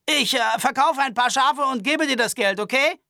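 high-pitched nervous Albert voice. Acted. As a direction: complain fussily and fast. Albert surrenders.

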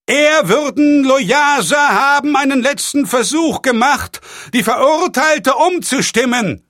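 high, loud call to the stands; shouting across a crowd. A narrator's reading. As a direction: project it to the far tribune. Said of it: "schrie Pater Xavier in Richtung der Tribüne". (laut zur Tribüne hinübergerufen)

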